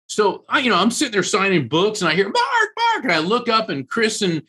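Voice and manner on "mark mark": very high-pitched urgent call; excited calling out. Retold or imitated. Friends call the author over to the device, excited, high-pitched. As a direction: mimic someone frantically calling a name.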